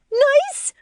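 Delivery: very high, rising screech. A narrator's reading. Lori outraged at her husband's word, incredulous screech. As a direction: screech it back in disbelief. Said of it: "she screeches".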